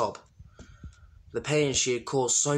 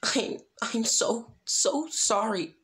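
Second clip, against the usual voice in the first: much higher, slow, breaking apology; tearful apology; 11 semitones higher, slower than usual. A narrator's reading. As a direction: plead through tears. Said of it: "she cried".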